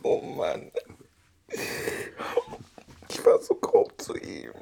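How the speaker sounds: choked remorse. Acted. Remorse over partner.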